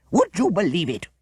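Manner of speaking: indignant and fierce. A narrator's reading. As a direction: scold with disbelief. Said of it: "he added fiercely".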